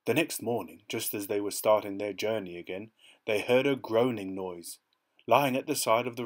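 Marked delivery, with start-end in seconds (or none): none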